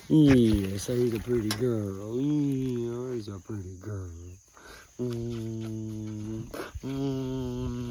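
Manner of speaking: soft, slow pet talk; cooing to dogs. Real, not acted.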